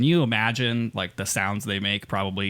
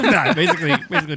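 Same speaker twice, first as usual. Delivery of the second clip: laughing; 5 semitones higher, 4 dB louder, much faster than usual. Real, not acted. Co-host mocking host's remark.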